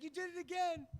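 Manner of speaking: put-on high tattling kid; little kid voice.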